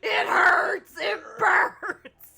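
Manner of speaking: loud, laughing fake cries of pain; mock wailing. Friend fake-wails to Arthur about burning, loud laughing mock cries. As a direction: wail it while cracking up.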